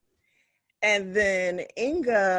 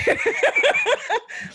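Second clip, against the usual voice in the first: loud high laugh; 6 dB louder than usual. Real, not acted. Remembering Chantrell's days.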